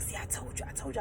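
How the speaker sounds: imitated panicky whisper; freaked-out whisper. Boyfriend hiding from her mother, panicked whispering. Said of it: "he freaks out and he's like".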